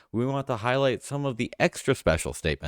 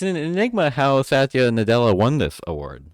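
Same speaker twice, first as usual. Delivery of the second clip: louder and higher, punching enigma; mock announcer punchline; 6 semitones higher, 8 dB louder than usual. Real, not acted. Host delivering the stolen enigma joke, hammed-up. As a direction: land the joke with a grand build. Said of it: "I stole your joke".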